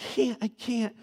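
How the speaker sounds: high-pitched mock whimpering protest; imitated crying child. Daughter refusing to go play, imitated tearful plea. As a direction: plead like an upset little girl. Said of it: "And she's crying".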